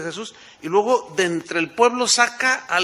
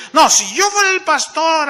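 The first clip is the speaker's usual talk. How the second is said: higher, louder mocking whine of "fuera"; 9 semitones higher, 7 dB louder than usual. A member telling the pastor he'd do better, mocking whine. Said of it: "la más socorrida".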